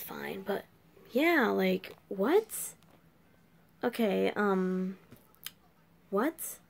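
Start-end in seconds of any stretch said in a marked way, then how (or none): none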